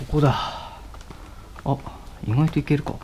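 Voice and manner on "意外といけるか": ordinary talk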